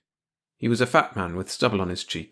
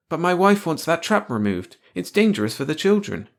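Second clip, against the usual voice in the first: high-pitched mild complaint; complaining; 8 semitones higher, 4 dB louder than usual. A narrator's reading. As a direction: protest on the family's behalf. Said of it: "George complained".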